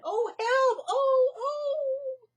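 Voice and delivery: very high, loud cry for help; panicked cry. Acted. Annie crying for help as she flees. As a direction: yelp in panic.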